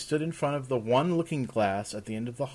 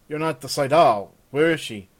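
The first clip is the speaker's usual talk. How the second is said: louder, higher impatient question; curt demand; 4 semitones higher, 9 dB louder than usual. Acted. Demanding where the woman is.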